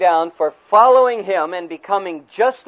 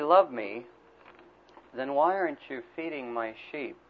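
serious; 4 semitones lower, 7 dB quieter than usual. Retold or imitated. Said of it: "Jesus gets serious".

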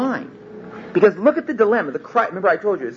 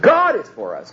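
God raised and punched; stress on God; 5 semitones higher than usual. Shown as a demonstration. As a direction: hit the word God. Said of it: "putting the emphasis on four different words".

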